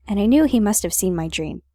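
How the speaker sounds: ordinary talk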